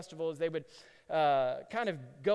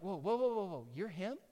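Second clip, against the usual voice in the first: rising, astonished questions; acted awestruck onlooker piecing it together; amazed realization; 4 semitones higher than usual. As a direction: start hushed, then build higher in wonder. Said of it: "But then others are like".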